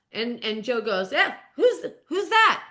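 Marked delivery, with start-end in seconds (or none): 1.1-2.6 puzzled query. Retold or imitated. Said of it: "you could tell he was serious".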